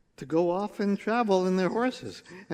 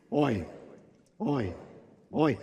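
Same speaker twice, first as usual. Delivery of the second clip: low, measured oi for others to join; groaning log carriers; 5 semitones lower, 5 dB quieter, slower than usual. Acted. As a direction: lead a steady, subdued groan. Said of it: "Say it with me".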